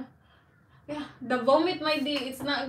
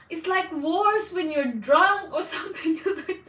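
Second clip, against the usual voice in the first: laughing while talking; 5 semitones higher, 6 dB louder than usual. Real, not acted.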